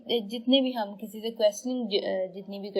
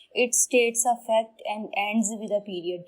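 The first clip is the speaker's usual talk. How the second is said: 1 definition read slowly; much slower than usual. Shown as a demonstration. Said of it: "ये वो sentences होते हैं".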